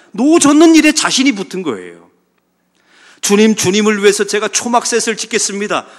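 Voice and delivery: loud, high proud boast; full-force preaching. Acted. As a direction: hammer out Peter's overconfidence.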